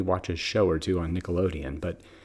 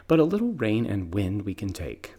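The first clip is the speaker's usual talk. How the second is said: normal talking voice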